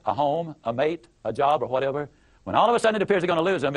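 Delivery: ordinary talk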